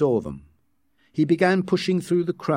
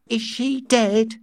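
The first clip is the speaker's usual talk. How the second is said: slow, high curious query; smiling child's question; 12 semitones higher, slower than usual. A narrator's reading. Twin asking about Linda, smiling curiosity. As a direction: ask it with a smile, high-pitched.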